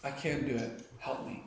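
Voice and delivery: very soft, breaking prayer; whispered plea. Retold or imitated. Believer at Jesus' feet, a whispered plea. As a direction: barely get the words out.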